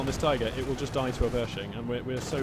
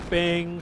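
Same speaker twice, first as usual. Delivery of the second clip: imitated hit sound; 3 semitones higher, 6 dB louder than usual. Imitating a tank shot hitting.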